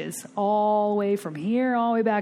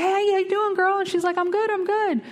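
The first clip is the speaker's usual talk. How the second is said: friendly hello; 12 semitones higher than usual. Retold or imitated. Both women greeting each other at the club.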